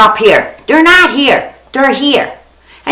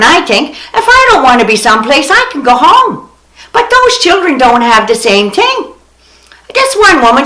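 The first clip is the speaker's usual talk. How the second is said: ordinary talk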